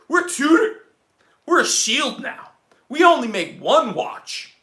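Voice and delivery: loud, high mock-proud announcement; a hammed-up, pompous brand boast, drawn out. Mocking the brand's new image.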